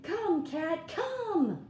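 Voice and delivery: high, loud call to the cat; dog calling cat. Acted. Dog calling the cat to help, high loud call. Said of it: "look at the dog saying".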